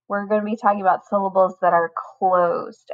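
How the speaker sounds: ordinary delivery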